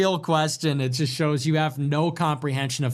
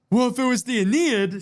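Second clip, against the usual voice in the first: mocking whiny chatter; 7 semitones higher than usual. Mimicking a chatter's complaint in a whiny high voice. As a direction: whine it like an offended commenter.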